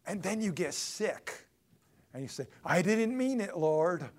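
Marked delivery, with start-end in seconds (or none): 2.6-4.1 loud, high plea to God; panicked pleading. Acted. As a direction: backtrack fast and loudly, begging. Sick man backtracks to God.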